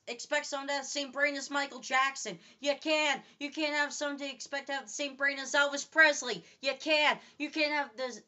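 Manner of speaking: normal talking voice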